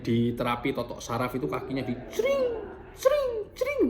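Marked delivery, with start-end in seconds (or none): 2.1-3.8 very high, ringing "cering" sound effect; imitated zinging jolt. Patient showing viewers how nerve therapy felt, high imitated zings. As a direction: mimic sharp zaps with a high-pitched "cring". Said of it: "gitu ya".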